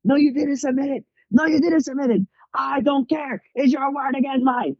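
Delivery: very high-pitched mock professor yell; imitated yelling. Student imitates professor refusing to listen, high mock yelling. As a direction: shout over the student, imitating an irate professor. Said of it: "Top of his lungs".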